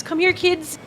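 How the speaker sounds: put-on a kind lady waving the kids over; calling the kids. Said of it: "She says".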